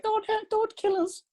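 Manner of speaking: very high, slow, fake-scared squeal; mock terrified squeak. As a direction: squeak it out like a cartoon coward. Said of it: "I was like".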